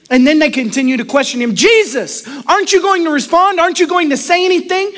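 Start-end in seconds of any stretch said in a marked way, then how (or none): 1.6-4.9 high, pressing accusers; badgering questions, pitched up; insistent questioning. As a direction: demand an answer in raised pitch.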